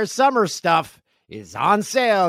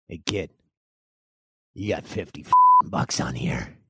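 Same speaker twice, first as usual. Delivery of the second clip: a clerk voice, much lower than his own; gruff store clerk; 9 semitones lower than usual. Retold or imitated. Clerk telling the kid her balance, low clerk voice. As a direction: drop low for the counter guy.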